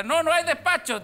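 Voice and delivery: high, loud shout from across the store; shouted answer. Retold or imitated. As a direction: yell it back from the far end of the shop. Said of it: "Entonces Julián le grita".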